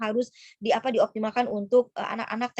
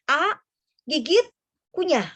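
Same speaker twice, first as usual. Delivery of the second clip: A, gigit, kunyah called out louder and higher; firm loud demo; 4 semitones higher, 5 dB louder, slower than usual. Shown as a demonstration. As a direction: bark each step like instructions to a child. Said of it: "Nah, itu harus". (peragaan tegas dan lantang)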